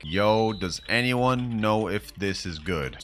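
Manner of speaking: slow, measured dictation; typing aloud. Acted. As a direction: spell it out word by word as typed. Dictates his chat message while typing.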